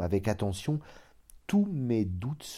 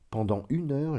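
ordinary delivery, nothing marked

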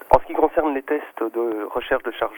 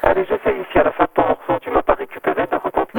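glitchy robotic phone line; distorted digital phone voice; 4 dB louder than usual. Real, not acted. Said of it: "tu as une voix de robot".

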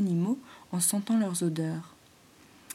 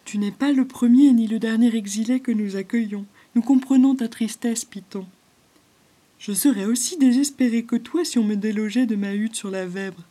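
louder, higher gentle beaver voice; kind beaver; 3 semitones higher, 8 dB louder than usual. Acted. Beaver Raguse consoles the exiled python, kind and louder. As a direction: comfort the sad snake kindly.

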